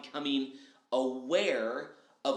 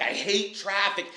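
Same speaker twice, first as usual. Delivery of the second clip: mock grumble; 5 semitones higher, 6 dB louder than usual. Acted gripe about traffic.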